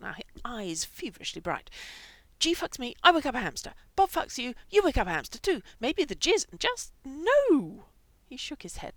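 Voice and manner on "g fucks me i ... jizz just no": loud, high agitated reasoning; excited loud. Acted. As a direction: work it out aloud, worked up. Frank loudly works out the theory.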